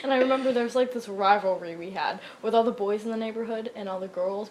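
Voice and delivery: ordinary delivery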